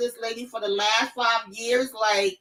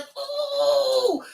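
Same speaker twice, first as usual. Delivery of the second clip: high cry of frustration; far-off high ooh of rage; angry high ooh; 13 semitones higher than usual. Real, not acted. Furious at character.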